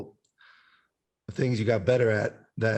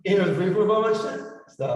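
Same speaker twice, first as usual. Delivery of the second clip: cave echo; 9 semitones higher than usual. Real, not acted. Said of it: "I like the reverb setting on that".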